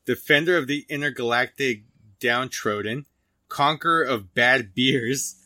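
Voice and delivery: slow, emphatic, declaimed beer description; loud product read. Real, not acted. Host reads the found product description to co-host, loud and emphatic. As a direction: read it out big and loud, word by word. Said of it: "I probably said that really fucking loud".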